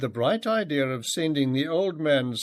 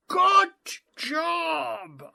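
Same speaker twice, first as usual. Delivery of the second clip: joyful yell of praise from afar; screaming across the water. A narrator's reading. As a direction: scream it to a boy far away. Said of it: "I screamed over the hundred".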